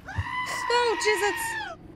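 high shocked shriek of "Jesus"; jolted out of their skin, scream it; startled scream. Real, not acted. Jump scare in horror game.

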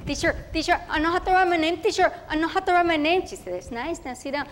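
eager chatty schoolgirl voice, Spanish mixed in; a proud first-grader telling her day in a rush; little girl Tere. Acted. Girl telling about her first school day, eager and chatty.

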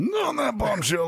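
presenter catchphrase. Acted. Show outro catchphrase.

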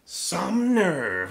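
a hen huffing in disgust; huffy. Acted. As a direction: puff up offended. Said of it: "huffed Gladys".

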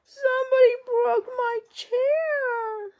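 high, slow sobbing cub voice; crying baby bear. Acted. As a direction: wail it like a heartbroken little cub. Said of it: "Baby Bear ... says".

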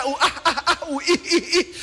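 hammed-up coy oohs and ahs; mock flirty squeals; mock giggling. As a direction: imitate people giggling at a dinner. Mimicking barbecue giggling wives.

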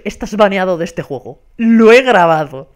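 mock angry. Streamer retelling an enraged player's threat, mock angry. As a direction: play the fuming player, hammering the last words. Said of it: "todo cabreado, y me dice".

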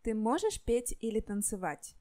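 ordinary delivery

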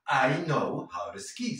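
I know how to ski with how to said like howdu; flapped t in how to. Shown as a demonstration. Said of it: "la vamos a pronunciar como una R suave".

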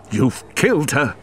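grieving man's angry cry. Acted. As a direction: hurl the accusation at him. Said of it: "he cried angrily".

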